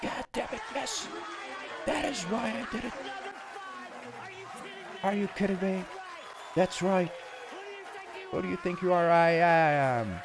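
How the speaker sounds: high, hyped echo of the clip; quoting the bowler clip. Retold or imitated. Streamer echoing the bowler clip, high and hyped. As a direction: ride the clip's triumph along with it. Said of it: "I love that clip so much".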